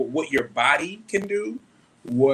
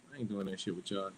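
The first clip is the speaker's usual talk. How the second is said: ordinary talk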